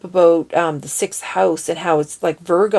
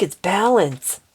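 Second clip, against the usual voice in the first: ordinary delivery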